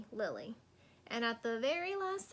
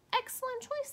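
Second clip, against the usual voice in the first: approving teacher; 13 semitones higher, 4 dB louder than usual. Acted. Teacher praising Lily warmly.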